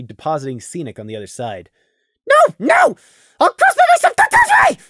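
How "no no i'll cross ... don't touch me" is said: high, loud, frantic refusal; panicked shout. Acted. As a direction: scream it like you're being grabbed. Prey refusing to be carried.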